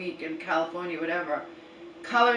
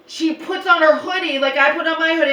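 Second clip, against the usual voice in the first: ordinary talk, nothing marked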